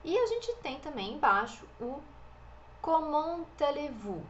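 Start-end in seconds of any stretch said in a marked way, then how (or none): 2.8-4.2 comment allez-vous with T linked into allez; French pronunciation. Shown as a demonstration. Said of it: "a liaison do T com A". (comment allez-vous com liaison do T)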